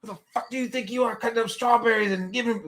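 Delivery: high-pitched indignant tirade; hammed-up squeaky fury, speeding up; mock raging child. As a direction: imitate a super pissed kid ranting. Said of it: "She's, like, raging".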